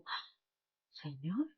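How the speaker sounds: sharp gasp, then a hushed word; gasp, then softly question in mock amazement; acted astonishment. Mary suddenly seeing her risen Lord, acted hushed astonishment.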